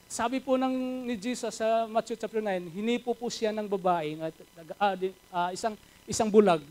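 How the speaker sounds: normal talking voice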